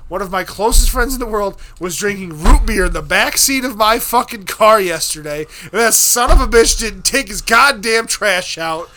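loud, heated tirade; retold screaming rant. Explaining his best friend's bottle to the cop, screaming. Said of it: "I was screaming".

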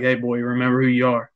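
normal talking voice

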